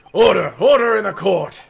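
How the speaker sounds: loud, commanding courtroom call; judge's shout. Acted. Judge calling the court to order, loud and commanding. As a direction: call it out hard over a noisy room.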